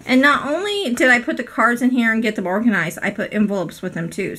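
ordinary delivery

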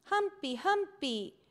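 put-on high, loud repeated toddler cry; little boy calling out happily; toddler shouting. A narrator's reading. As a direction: yell the name like an overexcited little boy. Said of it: "comenzó a gritar".

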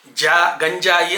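జా, గంజాయి with English-style j; slow JAA, gan-JAA-yi for జా, గంజాయి; wrong palatal ja. Shown as a demonstration. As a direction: show the faulty palatal జ in గంజాయి. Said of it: "తాళవ్యం పలకకూడదు".

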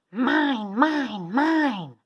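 loud, high roar of a greedy dinosaur. A narrator's reading. Greedy dinosaur bursting into the schoolyard, roaring. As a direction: roar it out, each word its own blast. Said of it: "they heard a roar".

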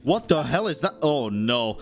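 normal talking voice

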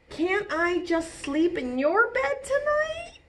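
high, pleading little-bear whine; whining. Acted. Birthday Bear begging to sleep in the bed, whiny high plea. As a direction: beg like a tired cuddly toy. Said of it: "whined Birthday Bear".

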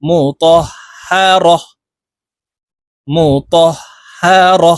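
"হা র" measured, ending on a breathed h; waqf reading. Shown as a demonstration. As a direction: stop on "র" with an audible final h. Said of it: "ওয়াকফের ক্ষেত্রে এইভাবে পড়তে হবে". ("হা র"-এর শেষে হা সাকিন করে থামা)

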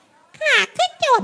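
very high, slow bandit announcement; disguised bandit voice. Shown as a demonstration. Wife showing husband the robber disguise, put-on bandit voice. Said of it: "ปลอมเสียงเป็นโจรนั่น".

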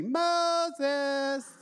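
a high, strange breathy call of the name; mock God calling out, not deep at all; silly God voice. Preacher spoofing God calling Moses by name, high and silly. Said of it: "like some deep, manly, godly voice".

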